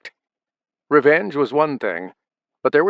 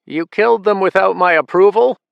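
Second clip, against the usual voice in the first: sharp, high, loud accusation; a mother confronting her son, angry and loud; anger; 9 semitones higher, 6 dB louder than usual. A narrator's reading. Mother rounds on son over killings, angry. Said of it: "her face a picture of anger".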